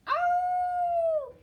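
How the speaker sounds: a long high wolf howl. A narrator's reading.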